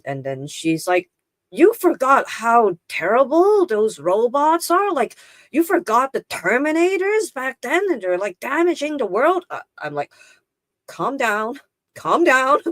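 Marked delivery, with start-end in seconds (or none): 1.5-9.4 high-pitched, hammed-up alarm; exaggerated, incredulous high-voiced warning; mock alarm. Retelling friend's alarm about robots. 10.9-12.6 high-pitched mock soothing; lightly acted, high-voiced plea for calm; retold placating reply. Retold reply to alarmed friend.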